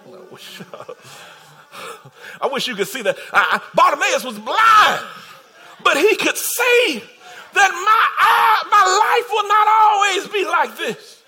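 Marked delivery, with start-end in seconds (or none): 3.3-11.2 passionate sermon cry; impassioned preaching. Real, not acted. Preacher voicing blind man's hope.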